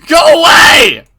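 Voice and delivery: high, very loud screaming; terrified scream. Acted. Keiichi screaming at Rena through the door, terrified scream. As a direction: scream her away in panic.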